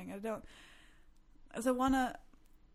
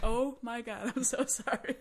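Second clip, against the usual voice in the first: louder retold apology broken by laughter; mock-horrified sorry through a laugh; 3 semitones higher, 6 dB louder, slower than usual. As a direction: apologise while cracking up. Retold apology after punching friend.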